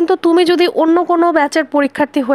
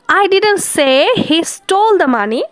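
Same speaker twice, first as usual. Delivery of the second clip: "I didn't say he stole the money" said very slowly, word by word; stressing a word; 4 semitones higher, much slower than usual. Shown as a demonstration. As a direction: lean on one word to carry the meaning. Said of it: "কোন মানেটাকে carry করছে".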